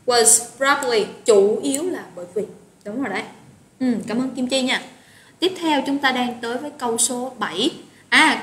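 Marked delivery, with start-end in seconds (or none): none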